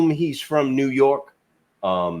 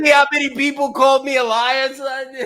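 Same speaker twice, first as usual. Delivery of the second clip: high, fast, loud gloat; 6 dB louder, faster than usual. Real, not acted. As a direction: rub it in with a grin. Gloating at the critics.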